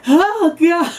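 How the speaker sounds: laughing panic. Retold or imitated. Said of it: "I'm like".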